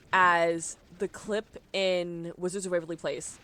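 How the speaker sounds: ordinary talk, nothing marked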